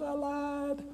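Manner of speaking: put-on high old professor voice; old man's voice.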